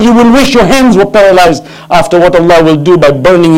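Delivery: high-pitched dramatic sermon retelling; fiery pulpit storytelling; impassioned preaching. Acted. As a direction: retell the confrontation with preacher's fervour. Preaches Zainab's rebuke of Yazid.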